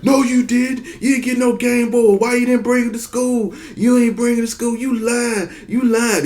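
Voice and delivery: very high, mocking kid voices; kids taunting. Classmates call him a liar, high mocking kid voices. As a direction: squeak out the taunts like schoolkids.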